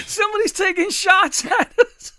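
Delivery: high, loud, laughing through the quote; laughing mock panic; laughing imitation. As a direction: mimic panicked drunks while cracking up. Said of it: "they're like".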